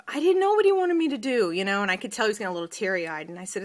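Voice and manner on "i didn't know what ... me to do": put-on higher, louder voice of a confused boy; imitating her son. Son telling mom about practice, imitated boy's voice. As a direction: voice the kid who didn't understand. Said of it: "and he said".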